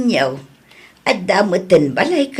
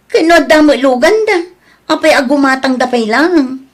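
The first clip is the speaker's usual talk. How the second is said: higher, louder incredulous questions; shouting in disbelief; angry disbelief; 4 semitones higher, 4 dB louder than usual. Acted. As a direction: scold them for the purchase. Parent upset they buy car.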